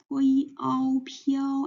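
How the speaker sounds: 泼一, ao, 飘 all high and level; first tone. Shown as a demonstration. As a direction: sound out 泼一, ao, then blend 飘 on one high pitch. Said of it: "and the first tone".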